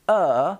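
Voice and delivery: "Uh" let out on a breath; relaxed neutral "Uh" breathed out; schwa sound. Shown as a demonstration. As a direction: demonstrate "Uh" as an exhaled schwa. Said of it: "Now it's a breath out".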